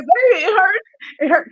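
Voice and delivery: high, re-enacted whine of pain; pained plea. Wife telling her husband about the pain, high pained plea.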